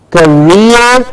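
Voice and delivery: career as "kuh-REER"; rear ending. Shown as a demonstration. Teacher shows how career ends, stressed "kuh-REER". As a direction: land on a long REER in career. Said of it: "with a rear at the end".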